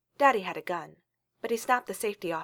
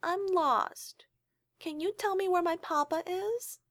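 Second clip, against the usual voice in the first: put-on very high, breathy little-girl whisper; baby-like voice; 11 semitones higher, slower than usual. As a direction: sound tiny and lost to fool him. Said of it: "putting on her most baby-like voice".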